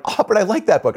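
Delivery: high, plaintive mom imitation; hurt mom voice. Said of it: "And she's like".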